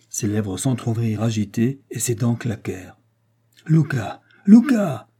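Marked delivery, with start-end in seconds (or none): 3.7-4.2 appel inquiet, un peu plus haut; premier appel. A narrator's reading. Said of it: "s'écria Don José". 4.5-5.1 cri aigu, bien plus haut et plus fort; cri désespéré. A narrator's reading. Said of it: "s'écria Don José".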